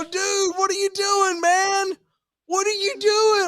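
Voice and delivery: very high frantic protest; hammed-up panicky questioning; mock exasperation. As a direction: plead with dad in acted disbelief. Acts protesting dad's gag.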